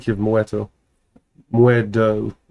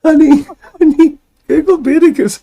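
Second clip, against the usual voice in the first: high giggly plea; 6 dB louder than usual. Real, not acted. Mock urgent plea.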